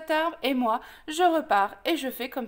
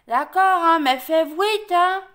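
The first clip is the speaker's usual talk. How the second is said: loud, higher, garbled wolf voice; talking open-mouthed; 4 semitones higher, 8 dB louder, slower than usual. Acted. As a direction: speak without closing your mouth. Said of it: "Surtout, ne bouge pas". (parler fort, la gueule grande ouverte)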